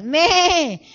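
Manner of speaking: loud, high imitation bleat; sheep bleat. Shown as a demonstration. Pastor shows how little sheep sound, loud bleat. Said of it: "¿cómo es que hace la ovejita?".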